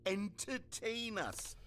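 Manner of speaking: mock demand. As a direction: drag it out like a bored crowd.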